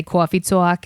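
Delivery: "coffee talk" said as "cwoffee tawk" in an exaggerated New York accent. Shown as a demonstration. To the podcast host, showing the camp routine in a hammed-up New York accent. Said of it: "do a New York accent like that".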